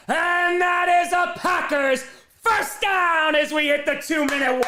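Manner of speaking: drawn-out build exploding into high, loud shouting; live play-by-play. Real, not acted. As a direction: shout the big catch in long, high, stretched words.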